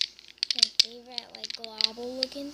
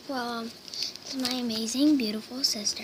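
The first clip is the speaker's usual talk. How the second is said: usual speaking voice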